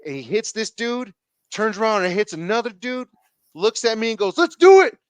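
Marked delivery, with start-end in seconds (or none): 4.4-5.0 shouted challenge. Retold or imitated. Frank calls him into fight.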